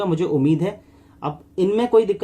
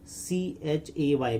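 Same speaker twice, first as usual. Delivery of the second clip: drawn-out teacher spelling, letter by letter; slow spelled-out demo; 3 semitones lower, 7 dB quieter, much slower than usual. Shown as a demonstration. Teacher spelling the sound for shorthand students, very slow. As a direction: spell out सीएचएवाई very slowly, then say चे. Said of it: "बोलते हैं चे".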